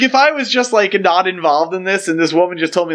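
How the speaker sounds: ordinary talk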